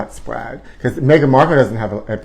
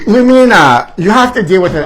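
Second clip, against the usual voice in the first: loud scolding; 6 semitones higher, 5 dB louder than usual. Real, not acted. Telling Lumina off.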